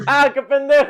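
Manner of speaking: high, loud squeal after a shot. Real, not acted. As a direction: squeal it out, tipsy and rowdy. Yelping after downing a shot.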